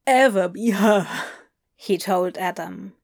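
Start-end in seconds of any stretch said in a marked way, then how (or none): 0.1-1.1 mildly strained, emotional vow; voice breaking on last word. Acted. Crowley admitting it to Adam, voice breaking. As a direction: let the last word give way. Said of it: "his voice breaking on the last word".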